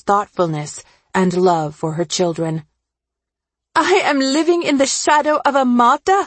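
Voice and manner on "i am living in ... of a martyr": high, fast, indignant outburst; narrator's contained scream, pitched up and rushed; screamed complaint. A narrator's reading. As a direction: rail in a high character voice. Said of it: "Omi screamed".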